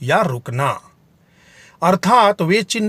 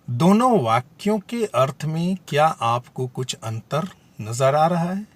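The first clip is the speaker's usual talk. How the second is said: usual speaking voice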